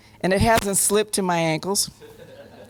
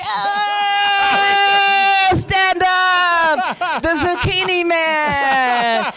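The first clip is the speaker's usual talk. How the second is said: calling out; 8 semitones higher, 4 dB louder, much slower than usual. Retold or imitated. Director calling her out to stand.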